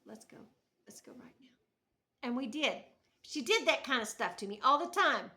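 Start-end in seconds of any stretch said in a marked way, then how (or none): 0.1-1.5 hushed, much softer; embarrassed whisper to sister; urgent whisper. Retold or imitated. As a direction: whisper it so the mourners won't hear. Embarrassed, fleeing mourners.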